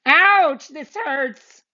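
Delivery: put-on high, pained little boy cry; yelp. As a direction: yelp in sudden pain. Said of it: "he yelped".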